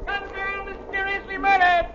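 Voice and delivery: high newsboy shouting headlines; newsboy calling. Acted. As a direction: hawk the papers, high and slow.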